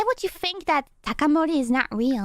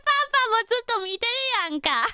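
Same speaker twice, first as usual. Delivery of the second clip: ordinary talk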